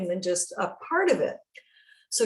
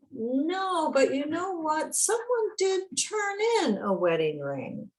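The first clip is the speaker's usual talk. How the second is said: fireman's reply pitched much higher, a little surprised; voicing the fireman; 10 semitones higher than usual. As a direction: lightly act out the fireman with news. Fireman voiced, surprising news.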